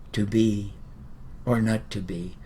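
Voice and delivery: low, soft mumbled line to himself; mock an actor brooding inward. Mocks internalized mumbled Hamlet.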